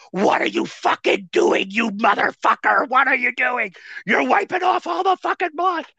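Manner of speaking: high, exasperated yell, rising as it goes; retold screaming. Bull screaming at the rookie wiping off blood, retold furious yell. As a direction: imitate an opponent screaming in disbelief. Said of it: "screaming at me".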